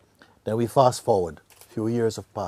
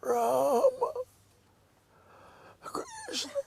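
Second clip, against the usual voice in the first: a high strained cry of each name; dying cry; much slower than usual. Shown as a demonstration. The dying father crying out high for his two sons. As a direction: cry out the sons' names with the last breath. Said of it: "like this, no?".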